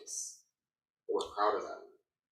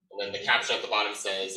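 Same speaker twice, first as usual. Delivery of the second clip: ordinary talk, nothing marked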